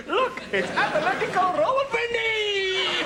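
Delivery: high, whooping team announcement; gleeful high-pitched football shout; excited announcing. Acted. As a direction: show off the team with a delighted cry.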